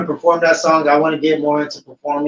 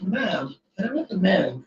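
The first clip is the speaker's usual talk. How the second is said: soft, higher-pitched customer-service voice; Starbucks barista; 4 semitones higher, 8 dB quieter than usual. Retold or imitated. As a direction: lilt it softly like a polite barista. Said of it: "in her Starbucks voice".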